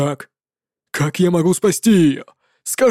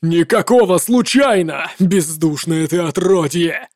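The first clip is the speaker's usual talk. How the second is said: roar; 3 semitones lower than usual. Acted. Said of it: "взревел Лихтер".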